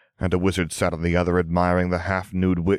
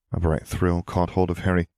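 ordinary delivery, nothing marked

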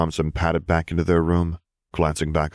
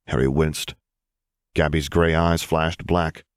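ordinary delivery

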